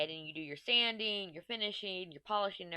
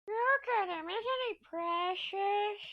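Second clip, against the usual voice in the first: cooing; slower than usual. Real, not acted. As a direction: gush like over a puppy. Cooing again over the dog.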